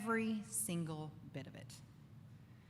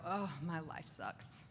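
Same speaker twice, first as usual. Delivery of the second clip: mock self-pity; 5 semitones lower, 7 dB quieter, slower than usual. Mocking a gloomy Facebook scroller.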